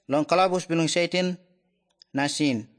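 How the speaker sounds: ordinary delivery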